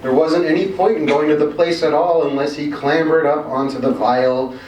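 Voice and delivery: ordinary delivery, nothing marked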